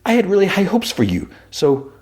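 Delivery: very high-pitched character voice; Mister Charles. Retold or imitated. Let-down caller to silent Fisk, high put-on voice. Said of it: "I had a, a voice for Mister Charles".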